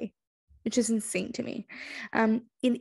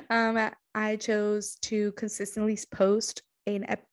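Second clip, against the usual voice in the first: ordinary delivery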